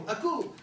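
"Akou" said ah-KOO, stress on the end; "Akou" called out like the dad's Listen; Greek word. Shown as a demonstration. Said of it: "in Greek they go".

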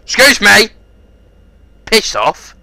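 high, loud outraged call; indignant shout. Real, not acted. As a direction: bellow it in disbelief. Outraged at being shot.

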